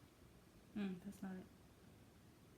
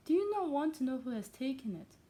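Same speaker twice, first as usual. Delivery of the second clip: louder, higher impatient question; impatient cry; 5 semitones higher, 6 dB louder than usual. A narrator's reading. Wife questioning her husband about the new tenant, impatient. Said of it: "cried his wife impatiently".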